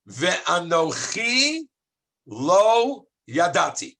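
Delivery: long drawn-out syllables; slow declamation. Shown as a demonstration. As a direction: stretch each word of the verse. Teacher slowly declaims the verse.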